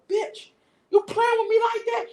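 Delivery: retold outrage, pitch jumping up; acted angry outburst. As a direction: imitate an aggressor going off. Acts out man's angry outburst.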